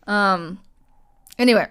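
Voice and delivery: ordinary talk, nothing marked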